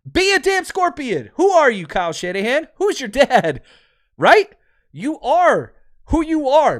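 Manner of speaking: high, loud, emphatic challenge; fired-up exhortation. Real, not acted. Host challenging the coach to be himself, fired-up. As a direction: hammer the moral home, voice pitched right up.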